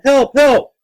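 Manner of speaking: loud, high cries of panic; yelling for help. A narrator's reading. Child pounds on locked door, yelling for help. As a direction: yell urgently to be let out. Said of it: "pounded on the door and yelled".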